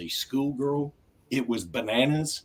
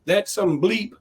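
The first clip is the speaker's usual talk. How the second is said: higher friendly Southern ribbing; imitating Houston guys; 8 semitones higher, slower than usual. Quoting the Seinfeld Houston guys, mock Southern ribbing. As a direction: ham up the good-old-boy buddies. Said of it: "all they kept saying was".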